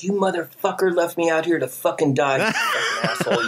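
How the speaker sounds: high, strained tearful rant; mock crying. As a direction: imitate a distraught outburst over the radio. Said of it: "I'm crying and shit".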